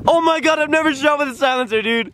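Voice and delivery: high, thrilled gushing over the guns; can barely contain it; squealing excitement. Real, not acted. Opening the gun cases for the first time, high and thrilled.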